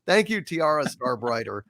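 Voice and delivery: normal talking voice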